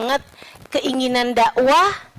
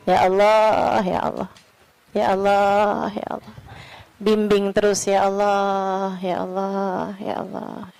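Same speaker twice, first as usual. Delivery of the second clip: soft pleading prayer; 5 semitones lower, 4 dB quieter, slower than usual. Real, not acted.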